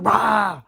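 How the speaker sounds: loud, high imitated roar; short hammed-up outburst of rage; mock angry roar.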